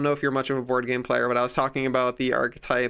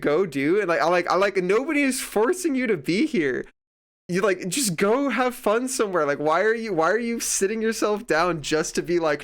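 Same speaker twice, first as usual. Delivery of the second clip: higher-pitched, exasperated retold rant; so mad; 6 semitones higher than usual. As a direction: plead in indignant disbelief, hitting key words. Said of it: "it was making me so mad".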